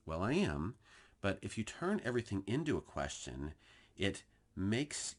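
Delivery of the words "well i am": ordinary talk, nothing marked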